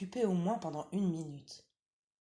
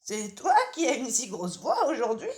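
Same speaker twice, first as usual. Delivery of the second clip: lightly higher, louder granny voice; old grandmother; 7 semitones higher, 10 dB louder than usual. Acted. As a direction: call out as a drowsy old granny from bed. Grandmother asks.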